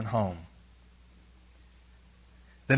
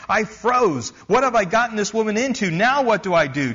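higher and fast, alarmed; panicked thought; 7 semitones higher, 3 dB louder than usual. Retold or imitated. Missionary frozen at the medium's attack, fast and alarmed. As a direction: rush it in sudden panic.

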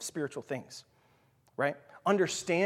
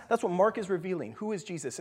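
usual speaking voice

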